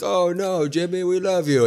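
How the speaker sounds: a sighing crowd begging Jimmy; crowd reaction. Retold or imitated. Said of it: "the crowd was like".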